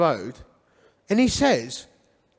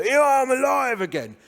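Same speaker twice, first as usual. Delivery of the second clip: corny risen-Christ act; hammy resurrected Christ; 5 semitones higher than usual. Retold or imitated. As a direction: announce it like a badly acted resurrection. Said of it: "pretend I was a resurrected Christ".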